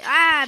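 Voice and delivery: sudden loud yelp; startled yell. Real, not acted. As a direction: yelp as you get hit. Killed in the game.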